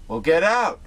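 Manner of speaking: mock yell get out; retold yell. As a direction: yell it at them. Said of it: "Yells at them and says".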